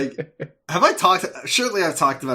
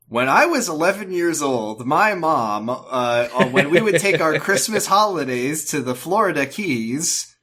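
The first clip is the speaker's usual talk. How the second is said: recipe blog voice; 4 semitones higher, 5 dB louder than usual. Pretend. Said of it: "it's like".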